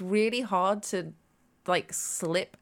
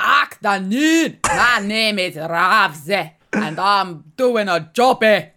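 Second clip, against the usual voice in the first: put-on slow, loud hammy Scottish accent; 8 dB louder, much slower than usual. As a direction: drawl it out in broad Scots. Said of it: "I do an amazing Scottish accent".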